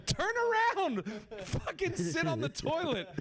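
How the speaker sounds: high-pitched retold yell; retold scream. Friend yelling at Ron in the bathroom, retold scream. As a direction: yell it high, like a frantic order. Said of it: "I'm screaming, going".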